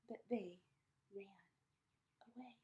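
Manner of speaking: ordinary delivery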